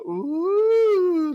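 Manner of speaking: high mocking "ooh", teasing; older kids jeering at the squeaky player; mocking squeak. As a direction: copy the kids jeering at a cracked voice. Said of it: "doing impressions of me".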